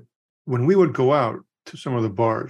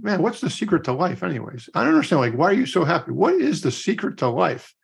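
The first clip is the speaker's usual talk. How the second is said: higher-pitched, imitated exasperated questioning; baffled pleading; 5 semitones higher than usual. Young guy asks the old cowboy life's secret, imitated bafflement. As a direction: act out a lost man wanting answers.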